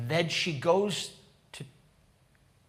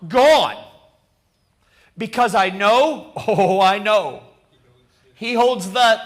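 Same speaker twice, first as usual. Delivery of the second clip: loud, raised-pitch proclamation; full-force preaching; 4 semitones higher, 8 dB louder than usual. Acted. Preacher leading the congregation through hope in the risen Lord, proclaiming. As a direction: declaim it boldly, leaving room after phrases.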